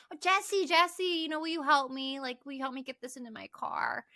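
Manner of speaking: high, sweet, coaxing voice asking a favor; sugary plea. Retold or imitated. Tiffany sweetly asks Jesse to load the trunk, high and coaxing. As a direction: put on a sugary please-help-me tone. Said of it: "but she's like".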